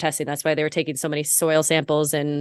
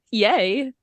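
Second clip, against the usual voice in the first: nerdy delight; 8 semitones higher than usual. Retold or imitated. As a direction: gasp then a small giddy cheer. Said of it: "my science nerdy self was like".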